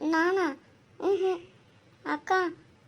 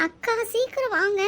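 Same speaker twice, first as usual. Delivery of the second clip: put-on childish call; 7 semitones higher than usual. Girl calling sister to hurry.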